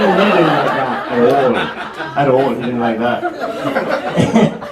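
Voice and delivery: slow, low deadpan with a laugh under it. Acted. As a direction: drag the words out flat and low. Deadpan repeat of the punchline.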